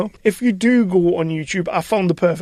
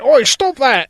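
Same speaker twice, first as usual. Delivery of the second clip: higher, louder mock shout; imitated indignant yell; 7 semitones higher, 7 dB louder, slower than usual. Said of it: "and he's like".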